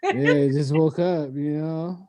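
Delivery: slow, groggy put-on; fake sleepy voice. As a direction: pretend you just woke up.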